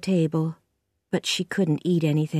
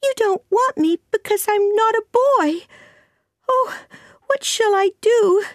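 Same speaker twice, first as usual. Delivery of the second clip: put-on very high, loud child's wail; distraught child, pitched right up; crying out; 5 dB louder than usual. A narrator's reading. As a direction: wail like a rejected little girl. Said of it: "cried the child suddenly".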